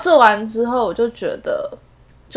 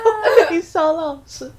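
slow, higher, through laughter; laughing echo; 5 semitones higher, slower than usual. Real, not acted. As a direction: repeat it while cracking up. 笑着重复对方的话. (笑着慢慢重复)

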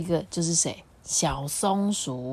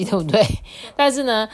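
ordinary delivery

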